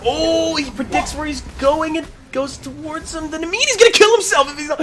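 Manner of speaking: high, loud hype call; excited shouting. Real, not acted. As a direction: yell the play as it happens. Caster shouts through a close fight.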